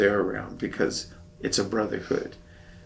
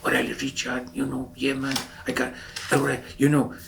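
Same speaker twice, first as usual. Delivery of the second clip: put-on slightly raised Chicano accent; Louis; 4 semitones higher than usual. Shipmate greeting his friend, Chicano accent. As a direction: imitate a shipmate's Chicano way of talking. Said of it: "he would talk like this".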